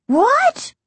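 loud, squeaky high yelp; startled, very high squeal; panicked high pitch. A narrator's reading. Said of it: "His voice was several octaves higher than normal".

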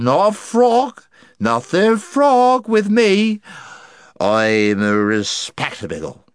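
very high, loud, slow slurring; indignant drunk, dragging the words; drunken protest. Acted.

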